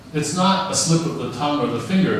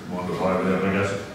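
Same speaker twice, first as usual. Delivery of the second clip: much lower, quick odd-voiced take; a different tone; 8 semitones lower, 3 dB quieter, faster than usual. Shown as a demonstration. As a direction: drop it low and rush it in a strange voice. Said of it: "what tone of voice you say".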